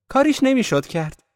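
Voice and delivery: very high, loud and exuberant; gushing cheerful cry. A narrator's reading. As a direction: cry out with thick merriment. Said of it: "دیزی با شادمانی غلیظی فریاد زد". (فریاد شادمانهٔ غلیظ و زیر)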